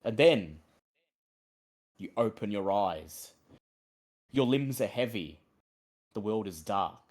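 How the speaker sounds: low, slow, ominous storyteller voice; dramatic narration. A narrator's reading. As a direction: draw the players into the darkness. GM narrates the death scene.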